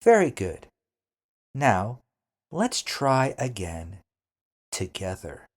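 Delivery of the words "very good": much higher and louder Very good; big praise. Real, not acted. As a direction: cheer the learner on. Teacher praising learner.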